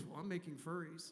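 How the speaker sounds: low, lazy stoner God voice. Retold or imitated. Comic stoner God explaining his furry hobby, low and lazy. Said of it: "And it's like".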